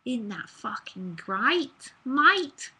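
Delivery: broad Australian accent, rising sharply on mate. Shown as a demonstration. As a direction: put on a drawn-out Australian accent with rising endings. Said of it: "So I did an Australian accent".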